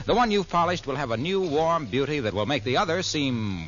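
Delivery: normal talking voice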